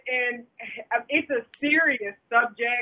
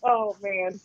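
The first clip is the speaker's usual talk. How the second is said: ordinary talk, nothing marked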